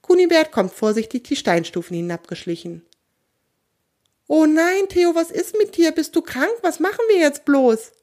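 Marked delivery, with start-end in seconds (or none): 4.3-7.9 high-pitched, anxious fretting; worried alarm. A narrator's reading. Kunibert fears his friend is sick, high and alarmed. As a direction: fret over a friend in a high voice.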